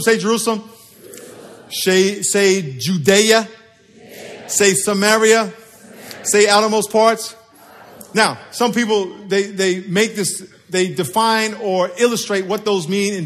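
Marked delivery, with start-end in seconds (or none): 0.0-7.4 slow, drawn-out calls to repeat; slow call and response; slow prompting calls. Shown as a demonstration. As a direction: lead the room, slow and clear. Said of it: "prompting congregation to repeat".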